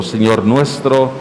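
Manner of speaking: normal talking voice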